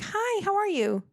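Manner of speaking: high bright shop-clerk greeting; high, fast store-clerk welcome; perky clerk. Retold or imitated. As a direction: chirp it like a cheerful American cashier. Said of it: "it would be like".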